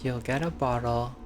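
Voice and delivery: ordinary delivery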